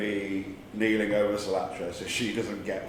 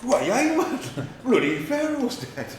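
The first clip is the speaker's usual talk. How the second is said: put-on higher Geordie accent; 13 semitones higher, slower than usual. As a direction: do your best Newcastle voice. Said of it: "in a Geordie accent".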